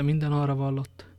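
understated, unimpressed repetition; dismissive echo. Acted. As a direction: hand the words back without excitement. Athos echoes the lover's words back. (visszafogott, lekezelő visszhang)

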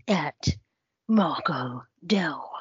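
slow, breathy whispered announcement of a name; dramatic whisper. Pretend. Introducing the guest's name in a slow theatrical whisper. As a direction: hush it out slowly like a grand reveal. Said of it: "Oh, that was brilliant".

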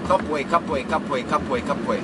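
rapid-fire repeated "Kapwe"; tongue-twister repetition; five times fast. Shown as a demonstration. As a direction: rattle off "Kapwe" in an even loop. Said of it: "Five times fast".

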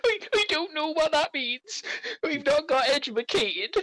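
put-on high, bewildered child voice; a timid halfling boy, baffled; confused kid. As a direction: sound lost and meek like a child.

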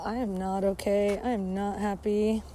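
ordinary talk

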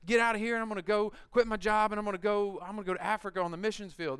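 mock the listener who wants to charge ahead; overeager excitement. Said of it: "some of you are like".

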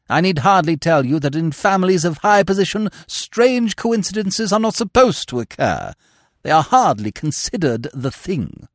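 Lady Bracknell laying down the rules of high society; haughty dowager. Acted. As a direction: look down your nose at the very idea. Lady Bracknell pronounces on families.

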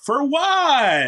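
high, baffled "For what?"; a hint of startled confusion; puzzled. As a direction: act out the confused reply, pitched well up. Puzzled reply to the liaison.

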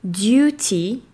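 British duty; "duty" with a Y sound and a clear T, "dyootee"; British English. Shown as a demonstration. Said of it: "on a le son Y". (en anglais britannique, "duty" avec un son Y et un T net)

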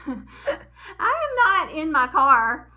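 real laughter breaking through the words; helpless giggling at her own blunder; laughing. Real, not acted.

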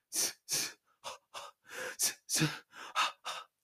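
rhythmic unvoiced breaths; panting while running. Tomohiro jogging along the highway, rhythmic panting. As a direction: breathe in-in, out-out as you jog.

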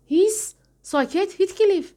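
high, loud urgent hushing; sharp hush. Acted. Nelly hushing Heathcliff to stop his rant, sharp and high. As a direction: cut him off with a sharp hush.

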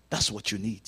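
usual speaking voice

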